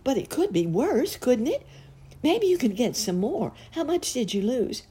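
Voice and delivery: high, eager clown voice; acted clown trying to cheer someone up; encouraging clown. A narrator's reading. Clown tries cheering up crying girl.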